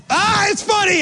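loud, very high mock mirth; acted forced laughter. Preacher acting out joining his host's laughter, hammed-up hilarity. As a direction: burst out as if suddenly finding it hilarious. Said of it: "And I start laughing".

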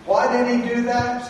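ordinary talk, nothing marked

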